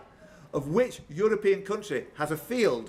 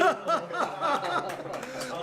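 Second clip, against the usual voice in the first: burst of laughter; 9 semitones higher than usual. Real, not acted. Draw result, host laughs.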